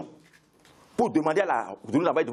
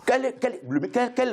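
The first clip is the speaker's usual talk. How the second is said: indignant sputter; 8 semitones higher, slower than usual. Real, not acted. Heated TV debate, groping indignantly.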